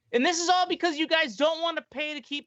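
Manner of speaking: high-pitched exasperated rant; retold phone yelling. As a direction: re-enact scolding the parents over the phone. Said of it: "I was yelling on the phone".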